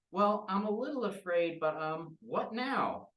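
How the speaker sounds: put-on puzzled child; little girl. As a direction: naive childlike question. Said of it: "Maisie goes".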